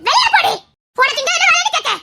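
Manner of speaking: high, sharp shout to get out; furious shout. Acted. Mother yelling at the intruder to leave. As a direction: throw her out with a yell.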